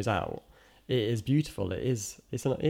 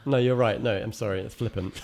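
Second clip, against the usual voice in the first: normal talking voice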